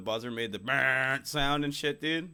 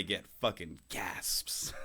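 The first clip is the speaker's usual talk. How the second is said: whispering; 5 semitones lower, 6 dB quieter, much slower than usual. Real, not acted. Joking boast, whispered.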